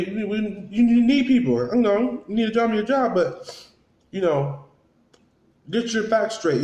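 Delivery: worked up. Real, not acted. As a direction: raise the voice, exasperated. Scolding, pitch up.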